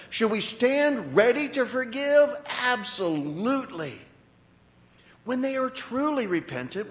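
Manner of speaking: high, loud emphatic sermon delivery; forceful preaching. Real, not acted. Preacher on true repentance before forgiveness, forceful.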